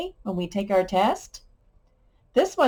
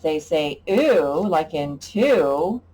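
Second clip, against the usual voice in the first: slow teaching voice; 2 semitones lower, 3 dB louder, slower than usual. Shown as a demonstration. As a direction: slowly model the ooh sound. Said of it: "make two sounds".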